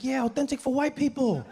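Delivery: high-pitched acted outrage; indignant telling-off. Local man scolding an unwanted visitor, high and indignant. Angry host tells visitor off.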